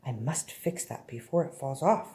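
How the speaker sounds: hushed, low inner voice of a story character; thinking to himself. A narrator's reading. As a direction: read it as a quiet private thought. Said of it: "thought Mister Bump to himself".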